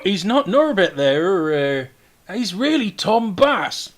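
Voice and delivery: high, loud, drawn-out correction; correcting figure. Acted. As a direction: set him straight, slowly and loudly. Castellan is told Norbert is Tom Bass.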